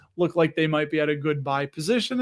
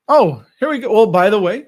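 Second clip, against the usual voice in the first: sudden loud, high "here we go"; sudden loud, eager kickoff; excited burst; 5 semitones higher, 9 dB louder than usual. Real, not acted. Excited start of the news.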